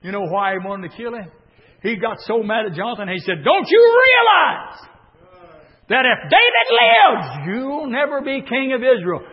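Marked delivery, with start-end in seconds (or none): 3.4-9.2 enraged king. Acted. Saul rages at Jonathan.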